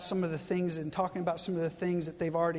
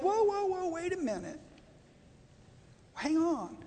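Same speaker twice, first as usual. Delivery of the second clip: much higher, startled "whoa, whoa" pulling people up short; mock alarmed disciples; 10 semitones higher than usual. Pastor voicing disciples blocking the children, high and alarmed. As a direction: throw up your hands and halt the crowd, pitched way up.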